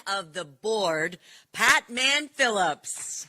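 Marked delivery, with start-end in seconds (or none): 1.5-3.3 drawn-out announcer introduction; slow, ringing emcee call of a name. Real, not acted. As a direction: stretch the name out for the crowd. Announcing the chairman to crowd.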